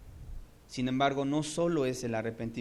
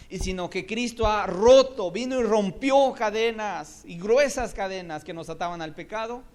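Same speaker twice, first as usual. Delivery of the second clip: louder, higher passionate build; preaching crescendo; 6 semitones higher, 8 dB louder than usual. Real, not acted. Preacher building fervour about broken chains. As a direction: rise up in fervour.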